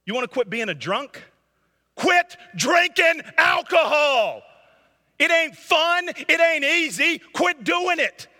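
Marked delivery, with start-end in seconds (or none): 2.0-8.3 loud, high, forceful rebuke to the congregation; preacher shouting. Real, not acted. Pastor shouting at addicts in the pews to quit, loud and high. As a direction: hammer each short command home. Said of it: "I'm not yelling at you".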